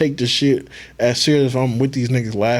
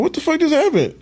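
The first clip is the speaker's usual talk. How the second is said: high, bewildered mock exclamation; baffled. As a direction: pitch it way up in disbelief. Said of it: "I'm like".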